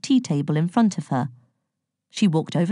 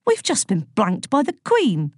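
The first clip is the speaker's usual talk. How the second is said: indignant; 4 semitones higher than usual. A narrator's reading. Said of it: "said Elliot indignantly".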